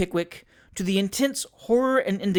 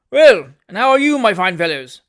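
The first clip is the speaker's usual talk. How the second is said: hearty greeting; 3 semitones higher, 11 dB louder than usual. A narrator's reading. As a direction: boom out a welcome. Wardle greeting friends.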